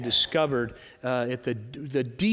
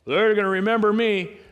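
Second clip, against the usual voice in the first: cocky boast; 4 semitones higher, 5 dB louder than usual. Acted. Voicing a boastful listener.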